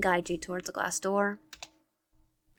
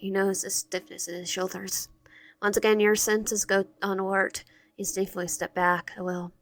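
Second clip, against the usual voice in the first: usual speaking voice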